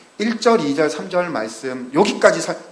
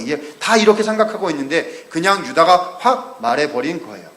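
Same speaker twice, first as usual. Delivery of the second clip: ordinary talk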